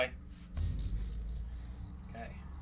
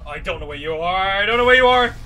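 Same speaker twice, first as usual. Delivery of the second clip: building panic; 6 dB louder than usual. Real, not acted. Player losing track of the attacker, building panic. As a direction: let the panic climb into a shout.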